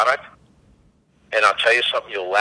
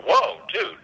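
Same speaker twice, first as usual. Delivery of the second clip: high-pitched retold surprise; taken aback; 10 semitones higher than usual. To a friend who walks away upset, taken aback. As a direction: lightly act out being taken aback.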